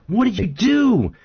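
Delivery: high, drawn-out frog yelling at the scorpion while sinking; drowning frog's outrage. Acted. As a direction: berate the scorpion as you go under. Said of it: "he looks up to the scorpion, and he says".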